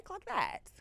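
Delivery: high-pitched sassy "Clock that", sung up high and then dropped; sassy high aside. Real, not acted. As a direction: toss it off in a high, knowing voice.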